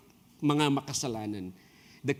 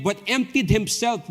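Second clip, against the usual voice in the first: scripture reading; 5 semitones higher, 3 dB louder, slower than usual. Acted.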